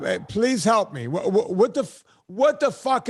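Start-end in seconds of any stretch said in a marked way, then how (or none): 0.3-1.1 pleading imitation. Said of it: "when someone is saying".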